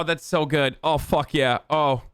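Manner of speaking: ordinary delivery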